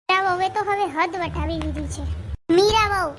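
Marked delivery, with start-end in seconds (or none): 2.6-3.1 calling her name out sharply, louder. Acted. Father-in-law summons Meera, stern loud call. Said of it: "રાડો પાડો છો?".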